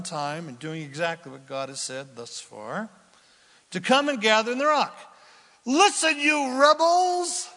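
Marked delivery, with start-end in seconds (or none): 5.6-7.5 loud, higher rebuke with a drawn-out rebels; angry rebuke. Acted. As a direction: scold the crowd from the rock. Moses scolding the people.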